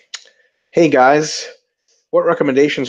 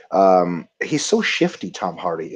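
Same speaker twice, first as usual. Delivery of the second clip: ordinary delivery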